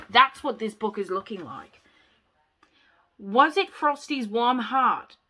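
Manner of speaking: normal talking voice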